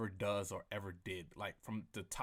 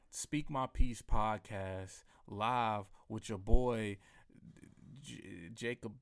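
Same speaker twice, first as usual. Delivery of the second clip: slow, drawn-out radio host voice; podcast announcer; 4 dB louder, much slower than usual. Acted. Host announcing his podcast to listeners, slow announcer read. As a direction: announce the show like a DJ.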